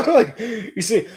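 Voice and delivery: laughing through words. Real, not acted. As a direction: say it while laughing. Laughing heard.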